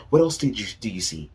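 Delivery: ordinary delivery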